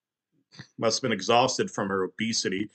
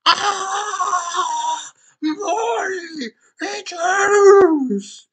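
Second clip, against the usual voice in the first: high crying yelp; yelping in pain; 3 dB louder, much slower than usual. A narrator's reading. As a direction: scream it as if stabbed. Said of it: "he yelped".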